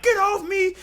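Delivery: very high get off me; mock yell. Fan retaliating in the brawl, high yell. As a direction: shove someone off. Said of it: "they're like".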